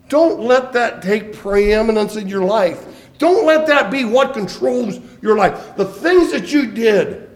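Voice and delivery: preacher pleading with Elijah and the congregation, loud; full-force preaching. Acted. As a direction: drive it home to the congregation. Preacher urges congregation loudly.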